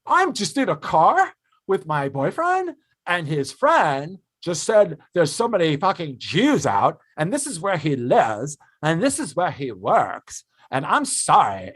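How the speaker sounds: high, slow, put-on voice of an indignant social media post; mock outraged poster. Mocking the fiancée who never posted it, high slow put-on voice. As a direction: lampoon someone publicly shaming a friend online, slow and high. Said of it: "and go".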